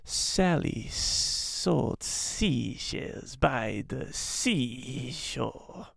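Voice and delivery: very slow, soft hissing reptile whisper; Trandoshan impression. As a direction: stretch every S into a long hiss. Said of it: "There's not enough S's in that".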